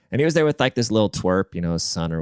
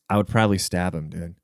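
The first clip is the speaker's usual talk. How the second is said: ordinary delivery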